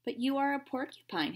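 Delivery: stated emphatically; emphatic. A narrator's reading. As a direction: insist on it like a kind uncle. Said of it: "his uncle stated emphatically".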